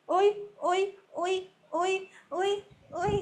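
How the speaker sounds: high, repeated little calls. Real, not acted. Playfully calling to baby.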